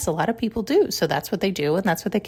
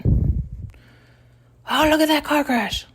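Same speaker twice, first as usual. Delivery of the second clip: mock gawker, higher and breathy-free, rubbernecking; gawking; 7 semitones higher, much slower than usual. Mocking people who stare at a car crash, gawking. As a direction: imitate someone gawping at a wreck.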